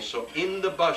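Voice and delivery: normal talking voice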